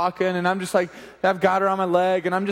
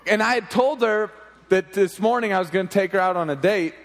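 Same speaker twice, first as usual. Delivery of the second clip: normal talking voice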